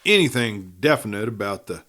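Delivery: ordinary delivery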